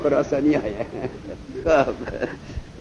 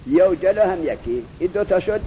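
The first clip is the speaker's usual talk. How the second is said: ordinary talk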